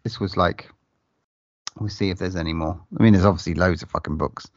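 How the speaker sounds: normal talking voice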